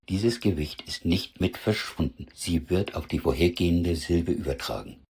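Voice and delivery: ordinary talk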